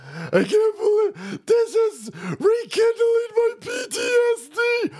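high, slow whiny voice, hammed-up; a mocking impression of a fragile man; mock whiny Will. Host mocking Will's trauma in a high whiny voice.

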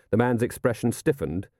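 ordinary delivery, nothing marked

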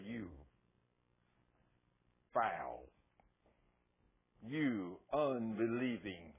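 low, very slow scolding with long pauses; stern rebuke. Acted. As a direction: play a disappointed master, dropping each phrase heavily. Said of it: "talk to you like that".

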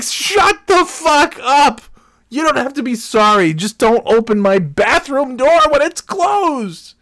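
high, loud, drawn-out exasperated yell; furious yelling. Retold or imitated. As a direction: bellow at an apologetic intruder, hammed up. Acted angry reply to repairman.

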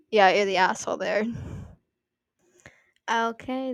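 ordinary talk, nothing marked

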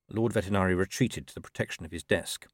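usual speaking voice

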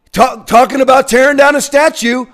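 normal talking voice

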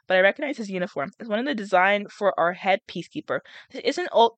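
plain reading voice

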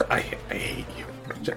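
breathy hushed whisper. Acted. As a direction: breathe it out quietly. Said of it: "She does".

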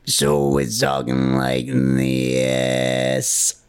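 slow, deep creaky vocal fry. Shown as a demonstration. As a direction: let the voice crackle down into fry. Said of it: "can we hear it?".

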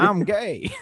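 high-pitched mock impression; D L Hughley.

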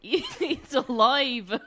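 put-on Russian accent. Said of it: "In a Russian accent".

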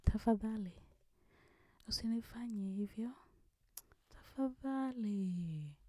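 soft whispered Swahili plea; Swahili begging impression. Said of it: "I'll try".

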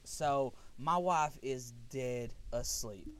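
usual speaking voice

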